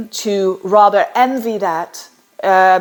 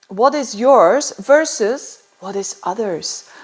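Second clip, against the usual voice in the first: ordinary talk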